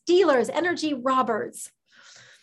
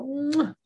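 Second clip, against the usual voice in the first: whispered air kiss. Real, not acted. Blowing a kiss to member.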